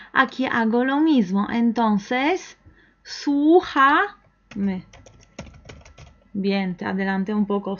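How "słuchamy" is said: very slow, high stretched syllables; drawn-out modelling. Shown as a demonstration. Modela la palabra alargándola. (palabra muy lenta, alargada y aguda)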